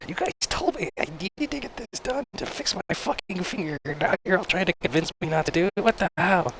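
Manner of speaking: very high, mock-outraged squawk; incredulous outburst. Patient to surgeon who keeps doubting him, incredulous outburst. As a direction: protest in hammed-up disbelief, voice way up.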